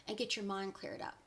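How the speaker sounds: ordinary talk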